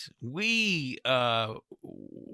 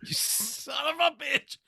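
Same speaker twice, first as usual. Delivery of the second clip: hammed-up angry shouting, higher; mock outrage; 12 semitones higher than usual. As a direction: shout in fake fury. Mock outrage at podcast drink.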